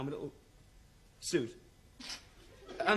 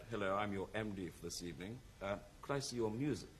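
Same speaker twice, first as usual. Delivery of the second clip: lower, softer, courteous MD voice; smooth musical director; 10 semitones lower, 9 dB quieter than usual. Retold or imitated. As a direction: greet the nervous act politely. Polished musical director greets him.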